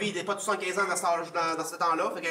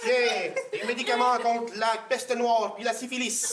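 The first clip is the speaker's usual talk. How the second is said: medieval villager; 6 semitones higher, 3 dB louder, slower than usual. Acted. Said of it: "époque médiévale".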